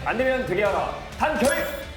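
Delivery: high barked motto and salute; korean military style. Acted. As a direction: call it out like a paratrooper. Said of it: "우리 군대식으로 우리 시청자분들에게 인사". (군대식 구호 경례)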